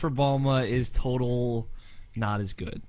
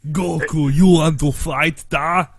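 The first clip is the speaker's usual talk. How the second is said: evil Russian; 2 semitones higher, 6 dB louder, slower than usual. Pretend. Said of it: "sound like an evil Russian".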